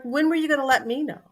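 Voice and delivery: retelling how you snapped at your father; exasperated outburst. Retold or imitated. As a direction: fire it off at your dad in disbelief. Daughter confronts dad over reports.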